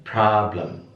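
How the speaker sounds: American 'problem' with open ah, prah-blem; American pronunciation. Shown as a demonstration. Teacher contrasts accents for students, American pronunciation. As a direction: open the first vowel of 'problem', American style. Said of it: "Kalau American".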